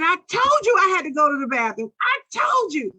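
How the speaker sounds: put-on child's tearful shriek of blame; screaming. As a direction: yell it back at the teacher, furious. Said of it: "I started screaming at her".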